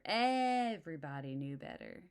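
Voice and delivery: ordinary delivery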